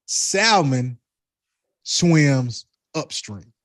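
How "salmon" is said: Salmon with an audible L; Salmon as "SAL-mun", said deliberately; own pronunciation. Real, not acted. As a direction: sound out the L in Salmon. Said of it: "I pronounce it like I pronounce it".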